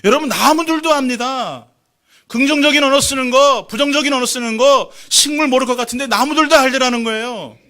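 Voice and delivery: fervent pulpit cry; full-force preaching. Acted. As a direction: hammer the point home to the congregation.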